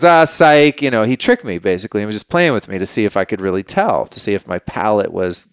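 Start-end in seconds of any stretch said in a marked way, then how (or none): 0.0-0.8 raised, loud mock gotcha; spring the prank on them; teasing retold punchline; gotcha reveal.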